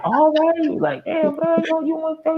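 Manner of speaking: high mock voice of an eager cellmate; eager homie. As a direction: toss it off high and keen. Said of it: "So he was like".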